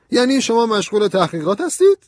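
loud, high-pitched challenge; incredulous colonel. A narrator's reading. Colonel challenging Ben about his investigations, incredulous and loud. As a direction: throw the question back high and loud.

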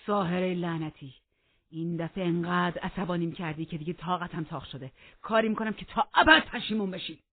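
loud curse rising to a threat; angry threat. Acted. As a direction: build in fury to the last words. Heathcliff curses and threatens Catherine.

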